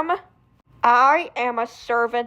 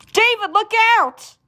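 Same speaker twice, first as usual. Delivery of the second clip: higher, louder warning yell; urgent warning cry; warning shout; 9 semitones higher, 4 dB louder than usual. Acted. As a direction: shout to stop him. Warning before the crash.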